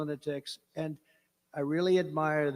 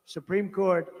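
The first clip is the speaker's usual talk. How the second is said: ordinary delivery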